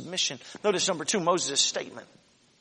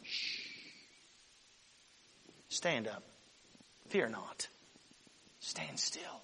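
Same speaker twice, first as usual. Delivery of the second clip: soft, low whispered commands; hushing whisper; 8 semitones lower, 11 dB quieter, slower than usual. Retold or imitated. Moses hushing the frightened people in a whisper. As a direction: hush them before the miracle. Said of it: "Moses says".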